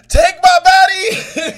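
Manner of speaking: high, slow, loud dramatic plea; dramatic mock cry. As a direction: ham it up like an anime hero. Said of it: "he was out there talking about".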